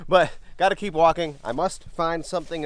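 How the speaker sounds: rally yourself to keep searching; breathless treasure hunter. Real, not acted. Hungry hiker pushing on.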